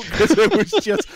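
high squealing laugh through the words. Real, not acted. Laughing squeal while telling friends.

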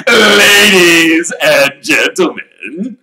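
booming, slow showman address; loud announcement. Acted. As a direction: call the room to attention. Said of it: "he said loudly".

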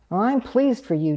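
ordinary delivery

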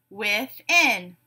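"Within" split in two, with-in; syllable by syllable. Shown as a demonstration. Teacher giving a word to blend, said syllable by syllable. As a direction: leave a gap inside "Within". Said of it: "say the syllables in a word".

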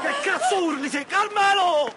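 high, agitated shouting; alarmed yell. Acted. Reacting to a screaming man, alarmed yell.

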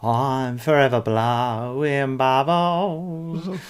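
slow, drawn-out Cockney crooning of the terrace song; Cockney singalong. Pretend. Fan showing off the song in a Cockney accent, slow and sung. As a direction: belt it like an East End football fan. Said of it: "fun song to sing in a Cockney accent".

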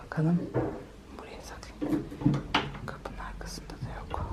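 hushed whisper while searching. Real, not acted. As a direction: whisper so she won't hear. Searching quietly for hiding child.

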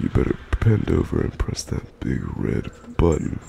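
breathy sultry whisper; seductive whisper. Acted. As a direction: whisper it like a tease. Sultry crude line, unvoiced.

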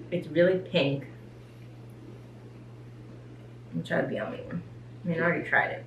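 talking between bites; chewing while talking. Real, not acted. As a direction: mouth partly full of food. Seen 1: Man bit burger at -5, appears still chewing while talking.

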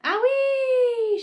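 high, loud, sustained shriek; scream. A narrator's reading. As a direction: scream it out in one long note. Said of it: "she screamed".

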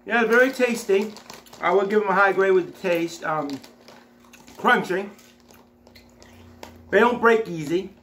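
chewing while speaking; talking between bites; mouth full of chips. Real, not acted. Seen 0: Left speaker put a chip in at -1.0; cheeks full, chewing while talking.

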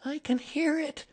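worried high voice, question rising in volume; anxious Piglet. A narrator's reading. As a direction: fret as a frightened tiny animal. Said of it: "said Piglet anxiously as they got near".

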